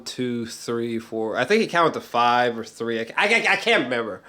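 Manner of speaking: ordinary talk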